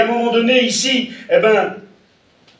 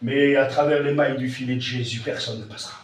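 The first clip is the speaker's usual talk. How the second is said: hushed; 5 semitones lower, 6 dB quieter than usual. Acted. Dramatic drop after shouting.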